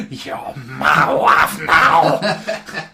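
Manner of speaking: drawn-out laughing shout of mock triumph. Joking to his co-host that he's taken the wife, a slow laughing shout. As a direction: crow it out slowly through laughter, loud.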